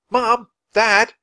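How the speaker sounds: calling out. A narrator's reading. Jack calling after the couple, loud. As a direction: shout across the ice to them.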